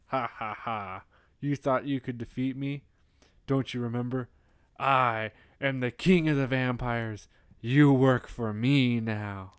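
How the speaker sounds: slow, gloating villain voice; hammed-up vampire king, drawing out the commands; Dracula. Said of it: "And then Dracula goes".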